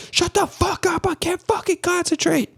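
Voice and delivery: very high, strained mock yell without volume; imitated scream. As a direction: snap as if screaming, volume held back. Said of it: "they're all screaming".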